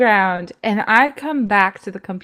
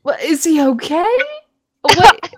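high, incredulous questioning, ending louder; retold alarm; 8 semitones higher, 5 dB louder than usual. Imitating reaction to news that a friend was shot, alarmed. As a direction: act out startled concern, jumping up in pitch.

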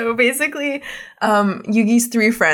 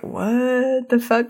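stunned disbelief; 3 semitones higher, much slower than usual. Retold or imitated. Everyone stunned by the transformation.

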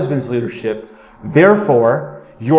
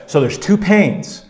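ordinary delivery

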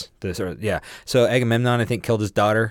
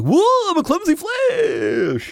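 a much higher, slow, goofy cartoon voice; goofy Flash; 5 dB louder, much slower than usual. Mimicking the Flash tripping to his cohost, goofy and slow. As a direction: stretch it out like a dopey superhero. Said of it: "he's like".

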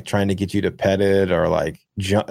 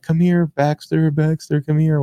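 calling the dog's name, pitched up and slow; mock yelling for a dog; 7 semitones higher than usual. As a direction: imitate a lady calling her runaway dog, higher and drawn out. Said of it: "screaming the dog's name".

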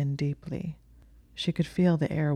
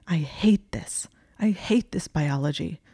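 fierce whisper. A narrator's reading. Hermione hating her biology, fierce whisper. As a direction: spit it out in a bitter whisper. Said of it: "she said fiercely".

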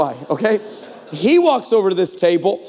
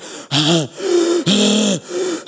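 gasp. Retold or imitated. Injured guy gasping for air.

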